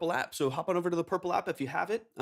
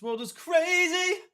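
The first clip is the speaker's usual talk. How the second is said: echoing under a bridge; 5 dB louder, much slower than usual. Real, not acted. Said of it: "That's so loud".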